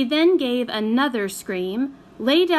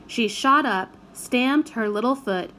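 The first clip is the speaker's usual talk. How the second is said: plain reading voice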